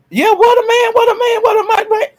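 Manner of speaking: high, thrilled admiration; excited. Real, not acted. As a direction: swoon over him like a fan. Admiring DG, repeated.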